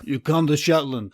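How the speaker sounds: put-on Brooklyn wise-guy accent. Riffing on the Sea Mother joke, Brooklyn accent. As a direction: play it as a Brooklyn tough. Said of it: "like, a Brooklyn accent".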